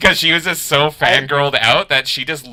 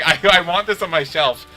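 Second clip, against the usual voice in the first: mock indignation; 7 semitones higher, 4 dB louder than usual. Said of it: "he was, like, legit mad".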